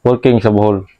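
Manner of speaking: radio shout-out. Acted. Reads shout-outs like a DJ.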